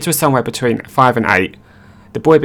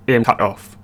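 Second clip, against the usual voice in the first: ordinary delivery, nothing marked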